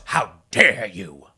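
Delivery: slow, low, loud hammed-up indignation; mock outrage. Woman answering a passing guy's howdy, mock-outraged. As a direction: parody a scandalized reply, drawn right out.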